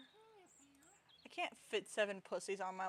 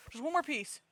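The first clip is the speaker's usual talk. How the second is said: higher, louder eager plea; eager pleading; 6 semitones higher, 7 dB louder than usual. Retold or imitated. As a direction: beg for just one more. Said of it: "I'm just like".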